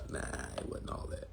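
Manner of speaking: unvoiced whispered aside. Real, not acted. Confiding to viewers, whispered. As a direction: hush it like a secret.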